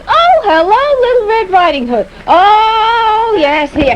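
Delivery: high, loud sing-song grandma; a doting old grandma greeting her; granny voice. Acted. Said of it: "and she says".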